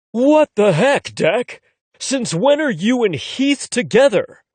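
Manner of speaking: loud, slow disbelief; can't believe what he's hearing; incredulous. A narrator's reading. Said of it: "with an incredulous expression".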